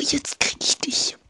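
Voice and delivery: hushed sneaky whisper. Acted. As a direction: whisper it like a stalker closing in. Sneaking up on an enemy.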